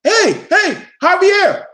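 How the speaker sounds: imitating a friendly shout across the room; mock eager greeting. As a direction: ham up cheerful buddy greeting. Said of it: "They act like".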